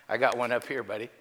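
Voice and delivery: hacking cough between words; coughing fit. Real, not acted. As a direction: talk through a coughing fit. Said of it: "Sorry, I'm hacking".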